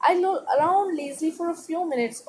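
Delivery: slow, clear read-aloud for a class. Acted. Teacher reading the paragraph to students, slow and clear. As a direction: read each word slowly to pupils. Reads text slowly.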